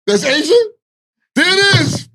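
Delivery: high excited yelling; excited shout. Acted. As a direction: hype it up, shouting.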